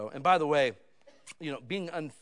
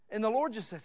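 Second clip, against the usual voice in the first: ordinary delivery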